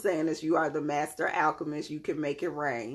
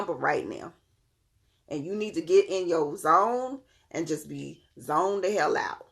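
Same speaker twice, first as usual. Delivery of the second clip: usual speaking voice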